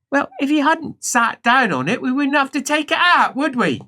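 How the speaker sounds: high-pitched telling-off; scolding helper. Acted. Helper blaming patient for the injury, acted scolding. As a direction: lecture the patient like a fed-up helper.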